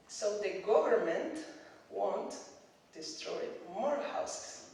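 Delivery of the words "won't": ordinary talk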